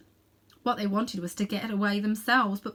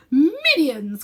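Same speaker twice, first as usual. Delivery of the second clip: loud furious cry; raging cry; 7 semitones higher, 11 dB louder than usual. A narrator's reading. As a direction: roar it in rage. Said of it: "cried the Queen".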